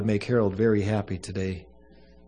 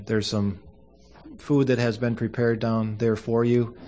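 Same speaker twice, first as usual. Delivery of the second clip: usual speaking voice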